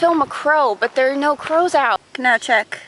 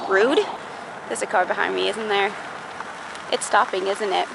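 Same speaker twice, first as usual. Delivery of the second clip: ordinary talk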